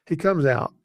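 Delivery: normal talking voice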